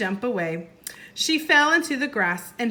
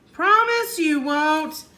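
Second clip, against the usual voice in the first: 1 pleading; 7 semitones higher, 6 dB louder, slower than usual. Acted. Jack pleading more.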